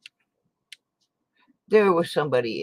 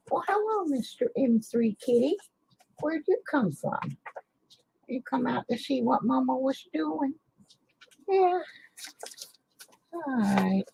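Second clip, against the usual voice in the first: cooing pet talk; 12 semitones higher than usual. Real, not acted. Greeting her cat Mister M Kitty, high cooing pet voice.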